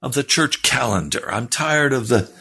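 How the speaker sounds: fervent preaching. Acted. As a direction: lift it like a revival sermon.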